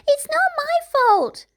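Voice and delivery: put-on high, loud, slow childish wail; child's cry. A narrator's reading. Little girl protesting to her sister, high wail. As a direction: whine it like a wronged little girl. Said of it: "Branda cried".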